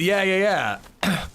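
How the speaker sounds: usual speaking voice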